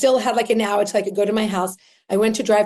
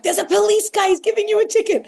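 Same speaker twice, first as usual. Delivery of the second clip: high, urgent imitation of a child; mock panicked heads-up; alarmed warning; 12 semitones higher than usual. Retold child warning of parking ticket.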